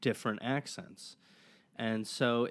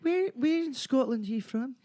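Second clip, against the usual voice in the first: put-on much higher Scottish accent for the local's question; Scottish man; 14 semitones higher than usual. Guest imitating a Scottish man questioning fake Scots, Scottish accent. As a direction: play the real Scot, curious and testing, in a Scottish accent. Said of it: "a Scottish person who's like".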